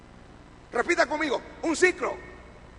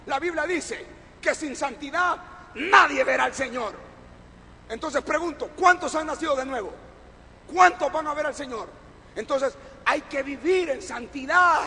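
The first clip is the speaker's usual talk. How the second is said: raised, urgent pulpit voice; forceful preaching; 3 semitones higher, 6 dB louder than usual. Real, not acted. Preacher asks who will see the Lord, forceful exhortation. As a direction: exhort them loudly, punching the key phrases.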